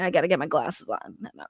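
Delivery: ordinary delivery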